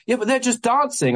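high-pitched exasperated comeback; retold argument, pitched high and exasperated; incredulous protest. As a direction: argue back in disbelief, pitch jumping up. Acted argument with venue owner.

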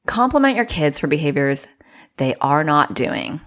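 ordinary talk

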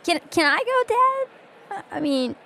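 put-on small, high, hesitant little girl; squeaky child voice. Dwarvish girl asking her dad to let her go, squeaky and childish. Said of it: "small, squeaky, childish voice".

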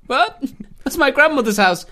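high, loud mock outrage; hammed-up baffled indignation; indignant local. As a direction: protest with the voice leaping up. Plays indignant local to invader.

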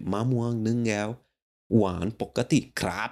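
"มะม่วงหนึ่งแก้ว หวานปกติครับ" rattled off fast in Thai tones; quick Thai order at the counter; Thai smoothie order. Shown as a demonstration. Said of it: "here you have to say".

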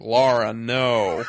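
loud mock-heroic plea; loudly imitating a movie hero's warning; mock alarmed shout. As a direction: call out a warning, hammed up. Acting out Bond's desperate warning.

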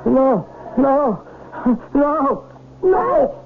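startled, panicked mumbling, pitch rising; waking from nightmare. Acted. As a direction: jolt awake in terror, gasping no. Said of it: "Why did you sound so terrified?".

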